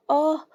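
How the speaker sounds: short, high, loud cry of pain. A narrator's reading. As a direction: yelp as if slapped. Narrator voices slapped woman's cry. (صرخة ألم قصيرة)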